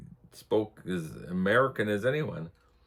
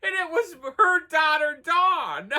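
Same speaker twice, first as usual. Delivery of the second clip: high, giggly, laughing as she talks; can barely get it out for laughing; laughing through it; 5 dB louder than usual. Real, not acted.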